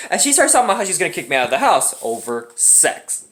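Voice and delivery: ordinary talk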